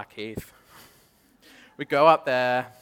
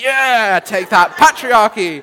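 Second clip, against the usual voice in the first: mock defiant feminist; 10 semitones higher, 16 dB louder, slower than usual. Friend mocking patriarchy, quoted.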